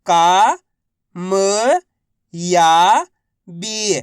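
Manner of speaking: राजनीतिक drawn out one syllable at a time. Shown as a demonstration. Teacher sounding out राजनीतिक for learners. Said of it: "तो बताइए मिलके क्या बनेगा".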